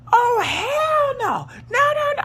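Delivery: very high, stretched-out outraged cry; high-pitched wail of refusal; retold hollering. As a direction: re-enact an alarmed outburst, drawing out the words. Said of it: "I'm hollering at my sister".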